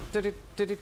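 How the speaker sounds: rapid mock babble of xQc's fast talk; sped-up imitation gibberish. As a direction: rattle off nonsense syllables like a fast-talking streamer. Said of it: "do you all sound like X Q C".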